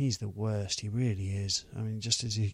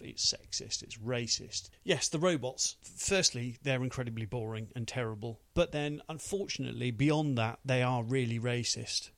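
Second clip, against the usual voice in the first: ordinary talk, nothing marked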